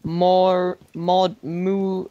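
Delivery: stretched syllables, trying each one; halting sounding-out. Real, not acted. Player puzzling over a backwards word, halting sounding-out. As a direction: grope through the word sound by sound.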